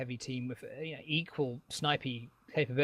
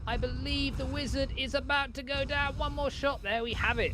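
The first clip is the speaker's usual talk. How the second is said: excited play-by-play; 9 semitones higher than usual. Real, not acted. Excited live play-by-play climax.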